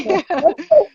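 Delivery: short giggle of surrender; amused laugh. Retold or imitated. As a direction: laugh as you give in. Laughs while agreeing to try.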